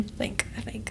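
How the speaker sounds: whispered uncertain aside; whispered doubt. Real, not acted. Second-guessing the commenter's name, whispered. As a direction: say it under the breath, unsure.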